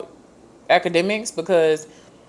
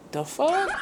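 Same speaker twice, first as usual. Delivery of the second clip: mock disbelief; 11 semitones higher, 6 dB quieter than usual. Deltas staring at her hand.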